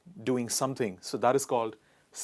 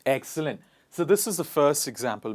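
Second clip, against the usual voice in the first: ordinary delivery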